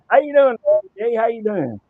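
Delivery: host hailing a viewer in chat loudly; loud greeting. Real, not acted. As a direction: call out to a friend arriving. Greeting viewer joining stream.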